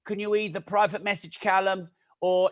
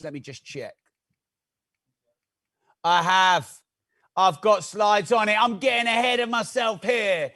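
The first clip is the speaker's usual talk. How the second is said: normal talking voice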